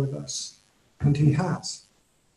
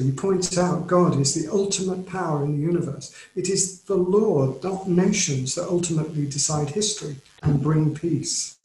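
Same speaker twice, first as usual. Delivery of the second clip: usual speaking voice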